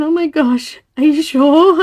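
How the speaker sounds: loud, high mock-panicked mum; imitated freaking out. Mum doubting her child's denial, imitated alarm. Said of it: "she was freaking out".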